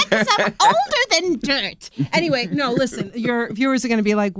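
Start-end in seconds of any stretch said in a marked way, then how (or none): 0.1-1.9 high, loud crone voice; witch voice. Pretend. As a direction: croak it like an old witch. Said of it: "my witch voice for that".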